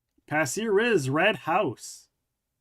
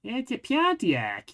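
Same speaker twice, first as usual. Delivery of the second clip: higher, slow Midwestern "Pontiac" with a drawn-out vowel; Midwestern accent; 7 semitones higher than usual. Retold or imitated. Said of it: "imagine a Midwesterner saying Pontiac".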